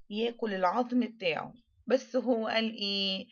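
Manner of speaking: ordinary talk, nothing marked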